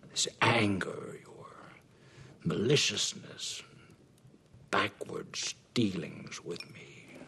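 slow accusation. Acted. Father listing accusations.

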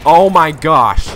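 loud, high, drawn-out exclamation; exasperated cry. Real, not acted. Player failing in the match, loud exasperated cry. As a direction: stretch it out loudly in exasperation.

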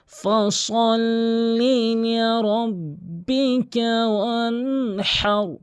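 solemn chanted verse; Quranic recitation. Shown as a demonstration. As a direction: draw out every syllable as tilawat. Reciting the surah.

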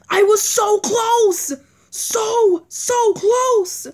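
high and slow, drawn-out whine; slow high frustrated whine; whining. Real, not acted. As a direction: moan it in frustration. Just lost the night.

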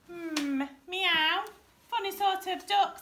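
high-pitched, musing feline voice; puzzled storybook cat with a loud meow. A narrator's reading.

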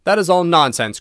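high, loud dismissive outburst; cried out. A narrator's reading. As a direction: brush it off loudly. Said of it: "cried Washington Otis".

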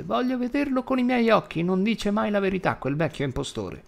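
put-on high, whiny old woman's complaint; petulant old granny voice; petulant grandma. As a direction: play the fussy grandma, high and peevish. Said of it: "rispose petulante".

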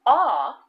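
the short O said as "aw"; drawn-out aw sound. Shown as a demonstration. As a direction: open "aw" vowel, held clear. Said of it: "The short O".

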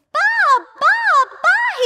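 very high, loud panicked baas; terrified bleating cry. Acted. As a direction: cry out like a trapped lamb. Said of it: "he cried".

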